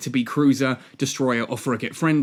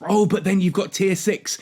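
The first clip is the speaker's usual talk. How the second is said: higher sneering quote; mocking voice; 5 semitones higher than usual. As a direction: mimic them with a sneer.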